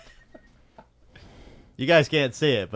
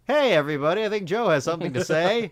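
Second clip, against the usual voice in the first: mock-cheery, louder and higher announcement; sarcastic; 7 semitones higher, 6 dB louder than usual. Boss announcing that an annoyed employee wants to speak, sarcastic. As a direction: call out with pointed, raised-pitch sarcasm.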